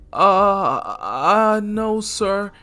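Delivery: timid, high-voiced stalling; nervous boy. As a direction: stammer it out in a scared kid's voice. Boy nervously refuses stranger.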